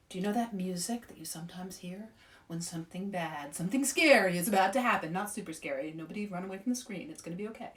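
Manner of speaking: hushed and soft, half whispered; soft spooky whisper. Real, not acted. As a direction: lean in and hush it.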